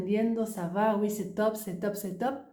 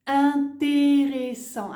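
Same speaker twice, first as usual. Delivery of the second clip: slow, drawn-out and louder; slow demo word; 5 semitones higher, 6 dB louder, slower than usual. Shown as a demonstration. Teacher modelling the word for student, slow and loud. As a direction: spell it out slowly, syllable by syllable. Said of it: "ahí sí".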